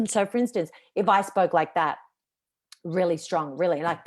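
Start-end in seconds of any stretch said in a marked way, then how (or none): none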